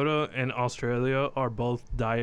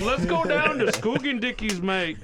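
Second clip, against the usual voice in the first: mock Australian accent; 10 semitones higher than usual. Buddies riffing on Australians, in a mock Australian accent. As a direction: put on a cartoon Australian accent, mate.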